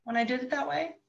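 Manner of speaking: put-on high, cheerful questions to child; bright check-in. Shown as a demonstration. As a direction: check how it felt.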